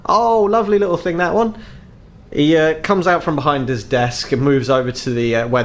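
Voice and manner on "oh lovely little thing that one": high, admiring elf shopkeeper; tall elf shopkeeper. Acted. Elf shopkeeper admires the little knife for a customer, high-pitched. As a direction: pitch it up, approving of the wares. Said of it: "you're met by a very tall elf".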